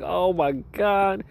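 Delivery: ecstatic. Retold or imitated. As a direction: stretch it out in pure bliss. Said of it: "I was like".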